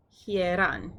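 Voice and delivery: hieran said hee-RAN, stress on the second syllable, not the diphthong. Shown as a demonstration. Teacher showing Greek accent placement, clear stress. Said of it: "the accent is on the second syllable".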